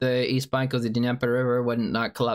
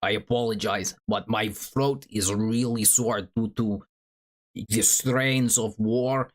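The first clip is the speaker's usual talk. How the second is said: put-on Zelensky accent. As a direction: put on a deliberate Ukrainian president's accent. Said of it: "please do the Zelensky accent again".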